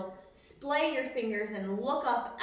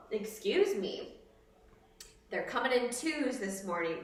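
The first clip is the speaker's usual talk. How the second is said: ordinary talk, nothing marked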